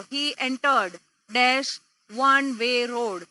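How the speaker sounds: ordinary delivery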